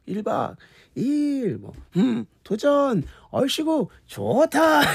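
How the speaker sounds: very high, stretched chant calls; crowd-call repertoire. Retold or imitated. Host recalling guest's stage repertoire, imitated high crowd calls. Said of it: "그래서 그 레파토리였잖아요".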